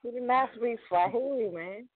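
ordinary talk

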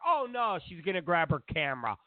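ordinary talk, nothing marked

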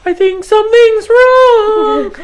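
very high, slow panicky voice; babysitter voice. Retold or imitated. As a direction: squeak it out like a nervous babysitter. Voicing babysitter in movie.

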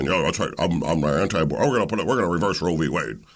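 fast, lightly voiced stump-speech parody; a hint of a smug candidate; mock candidate. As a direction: rattle off the pledge like a glib politician. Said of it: "you come out and you say".

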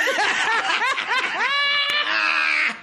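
squealing high laugh; high shrieking laugh. Real, not acted. Laughing at own reaction.

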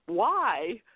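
high, loud retold protest; mock dismay. Host retells actress dreading her new co-star, mock dismayed. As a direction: plead in mock dismay, breaking into laughter.

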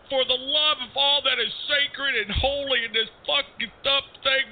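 high, strained exasperated plea; desperate pleading. Acted. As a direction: beg through gritted teeth. DJ pleads with the caller.

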